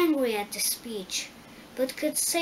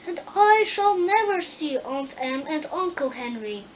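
raised, louder mild lament; beginning to cry; 4 semitones higher, 6 dB louder than usual. A narrator's reading. Dorothy fears never seeing her aunt and uncle, near tears. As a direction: lament it, pitched up, hint of tears. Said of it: "beginning to cry".

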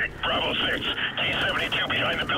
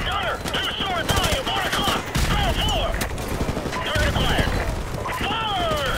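shouted fire orders; 12 semitones higher than usual. Acted. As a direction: call the target over gunfire. Gunner fire orders in combat.

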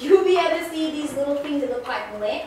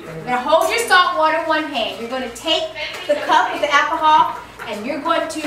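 normal talking voice

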